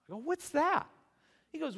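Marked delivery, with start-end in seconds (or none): 0.2-0.9 loud, very high incredulous question; baffled. Acted. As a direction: blurt it out in exaggerated bewilderment. Retold baffled reaction to tithing.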